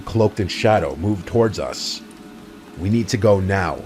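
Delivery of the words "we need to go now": ordinary delivery, nothing marked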